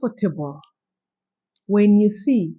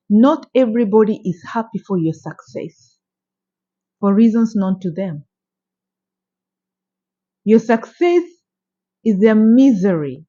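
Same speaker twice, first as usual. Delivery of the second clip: usual speaking voice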